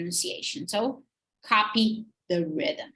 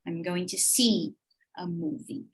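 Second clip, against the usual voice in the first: SEE stressed, pause, then softer falling MOVIE; stressed verb; 4 semitones lower, 4 dB quieter than usual. Shown as a demonstration. Teacher repeating answer for students, stressed verb in see. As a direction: lean on "see", then fall on the first syllable of "movie". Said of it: "the verb is gonna be stressed".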